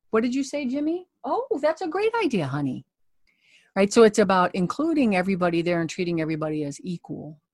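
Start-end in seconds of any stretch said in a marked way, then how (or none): 0.1-2.9 put-on high, sweet voice for a child; humoring a child. Shown as a demonstration. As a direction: play along with a kid, up high. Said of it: "You wanna be an ally of children?".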